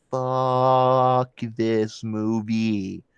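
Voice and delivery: very slow, dragged-out groan of a line; fed-up movie-goer dragging the words; drawn-out disgust. Retold or imitated. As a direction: stretch every word in weary contempt. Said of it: "made me go".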